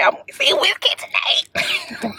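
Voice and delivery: high, drawn-out excited squeal; giddy high-pitched announcement. Real, not acted. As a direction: stretch it out high with concert excitement. Excited about tonight's concert.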